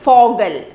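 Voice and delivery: Vogel with an f, fogel; German Vogel. Shown as a demonstration. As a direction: say Vogel with f at the start. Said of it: "we say".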